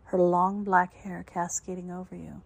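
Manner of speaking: ordinary delivery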